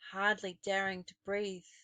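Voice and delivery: ordinary delivery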